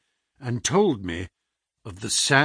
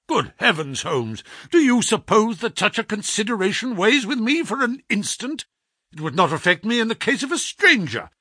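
indignant protest; 6 semitones higher than usual. A narrator's reading.

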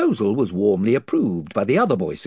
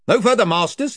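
higher gruff chieftain warning; invisible chief; 11 semitones higher, 5 dB louder than usual. Acted. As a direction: stop them from the empty air.

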